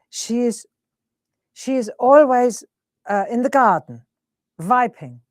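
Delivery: put-on German-accented mum, W said as V. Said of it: "and she's German, isn't she".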